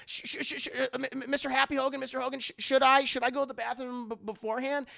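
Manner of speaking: high-pitched, tripping over words; stammering kid. Retold or imitated. Imitating Peter asking Mister Hogan permission, high eager stammer. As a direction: imitate an eager boy stuttering out a question.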